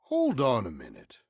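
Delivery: loud, sharp halt; sudden alarm. Acted. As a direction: stop everyone in alarm. Hears noise in the cellar.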